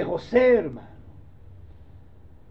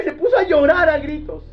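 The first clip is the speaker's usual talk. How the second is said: loud emphatic reading; 7 semitones higher, 6 dB louder than usual. Acted. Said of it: "voicing the weeping".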